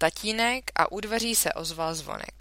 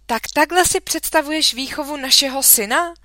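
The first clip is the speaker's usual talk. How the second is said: louder, higher indignant cry; exclaimed; 9 semitones higher, 10 dB louder than usual. A narrator's reading. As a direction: call out in outrage. (rozhořčené zvolání, hlasitěji)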